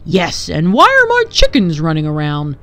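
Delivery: loud, gruffly indignant mayor; barked. A narrator's reading. Mayor complains about his loose chickens, barked indignantly. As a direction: bark the question, pitch leaping mid-phrase. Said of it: "barked the mayor".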